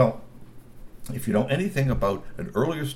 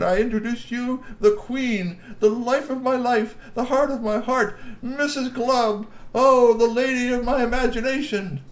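high, loud, slow flowery praise; doting troll husband; 12 semitones higher, 5 dB louder than usual. Acted. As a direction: gush over his wife grandly. Glub flatters his wife.

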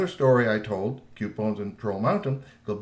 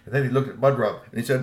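ordinary delivery

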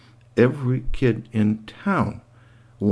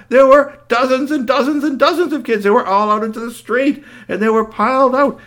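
high, loud breathless storytelling; alarmed narration; 11 semitones higher, 6 dB louder than usual. A narrator's reading. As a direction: tell it like a panic.

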